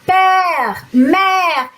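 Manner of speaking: père and mère with a wide-open "eh"; PÈRE, MÈRE, each on an open E. Shown as a demonstration. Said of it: "ele vai abrir o som do E".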